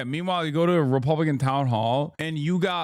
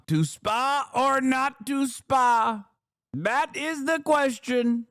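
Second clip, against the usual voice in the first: high, slow mock-grand recital; pitched way up and drawn out, playing it as grand drama; hammed-up Shakespeare; 10 semitones higher, slower than usual. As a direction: ham it up like a soliloquy in a mock Trump quote. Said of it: "He did literally say".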